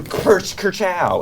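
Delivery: high, punchy kerchow noise; mock attack sound effect. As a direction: voice the hit like a cartoon blast. Makes attack noise when dealing damage.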